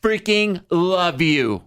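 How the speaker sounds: high, slow gushing; gushing fan. Retold or imitated. Drunk fan telling JC they love him, slow and high. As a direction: drag it out adoringly. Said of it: "And she goes".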